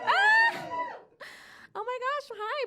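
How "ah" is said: high, loud delighted shriek; excited shriek. Acted. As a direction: squeal with joy at fellow Americans. Cheering at other Americans.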